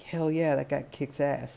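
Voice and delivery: lightly acted rowdy approval; imitated cheering crowd. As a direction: play a townsperson cheering on the hero. Imitating a fired-up town crowd.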